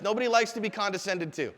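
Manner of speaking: ordinary talk, nothing marked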